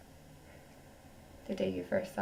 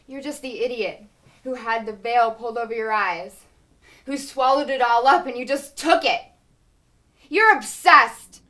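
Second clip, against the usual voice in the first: rising from sharp to a full scream; building furious shout; 4 semitones higher, 13 dB louder than usual. Acted. Wife berates her husband, building into a furious scream. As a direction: let the rage climb until it bursts.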